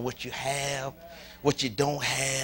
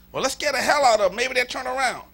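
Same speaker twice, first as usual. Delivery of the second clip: louder, quicker and higher, mock urgency; raised, hurried imitation of scare tactics; mock fear-preacher; 7 semitones higher, 7 dB louder than usual. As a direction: rattle off a scheme to scare people straight.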